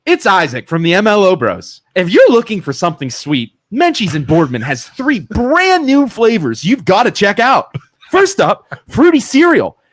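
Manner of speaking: fancy voice. Pretend. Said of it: "read it in my fancy voice".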